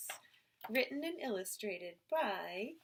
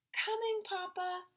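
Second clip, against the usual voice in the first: put-on higher child's voice; little boy answering; 10 semitones higher than usual. A narrator's reading. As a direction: call back like a small boy. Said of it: "Morgie said".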